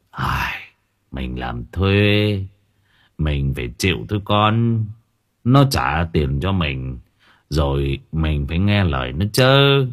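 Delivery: low, soft, slow sighing counsel; resigned old worker. A narrator's reading. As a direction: drag the words out with weary acceptance. (ông thợ già cam chịu, chậm rãi)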